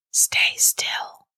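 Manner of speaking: voiceless, hushed command; eerie, insistent whisper; demanding whisper. A narrator's reading. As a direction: whisper an order down the line. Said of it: "managed to sound demanding".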